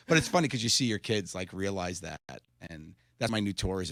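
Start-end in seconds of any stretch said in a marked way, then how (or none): none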